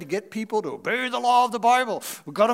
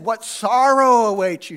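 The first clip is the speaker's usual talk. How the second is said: loud, slow, grave proclamation of woe; solemn warning; 8 dB louder than usual. Acted. Jesus warning the teachers of law, loud and slow. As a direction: declaim it slowly and loudly like a prophet. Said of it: "But yet Jesus said this".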